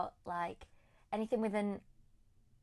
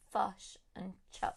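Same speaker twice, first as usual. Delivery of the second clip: Kiwi 'fush and chops' with short uh vowels; New Zealand accent; slower than usual. Shown as a demonstration. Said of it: "instead of fish and chips, it becomes".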